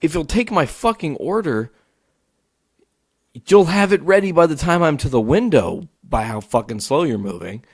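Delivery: high-pitched exasperated complaint, retold; livid rant. Fed-up customer ranting at the drive-thru, livid. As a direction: vent like a fed-up customer stuck waiting. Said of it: "I'm getting livid".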